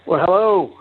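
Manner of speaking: normal talking voice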